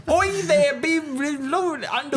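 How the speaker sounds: high-pitched mock Irish accent; badly faked Irish, high and garbled; hammed-up Irish accent. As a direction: burst into a silly Irish brogue. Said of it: "I'm Irish".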